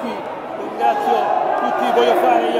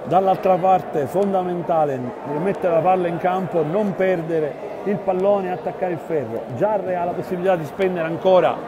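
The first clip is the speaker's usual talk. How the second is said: measured basketball commentary, building tension; live play-by-play; 3 semitones lower than usual. Real, not acted. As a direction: call the final seconds steadily.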